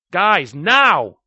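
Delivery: last desperate call to get moving; calling out urgently. Acted. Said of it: "Chuck called one more time".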